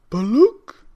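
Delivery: put-on old man spotting the kitten, loud and high; old man exclaiming. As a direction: point and call it out as the old man. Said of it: "said the very old man".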